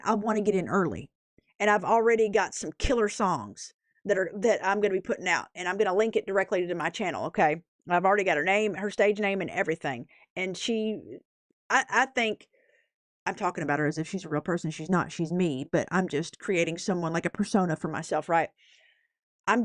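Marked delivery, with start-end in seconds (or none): none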